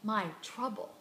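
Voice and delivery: ordinary talk, nothing marked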